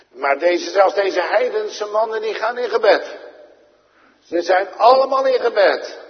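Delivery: loud, high, fervent pulpit voice; preaching at full force. Acted. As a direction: hammer it home to the congregation. Preaching peak about praying sailors.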